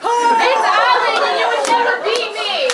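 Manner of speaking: loud, high, dragged-out taunt; hammed-up battle cry at the rival; mock rival challenge. Rival challenges before a rematch.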